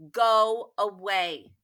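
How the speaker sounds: loud and slow; a slow loud go away; stern dismissal. Acted. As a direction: send him away firmly. Girl sends wolf away.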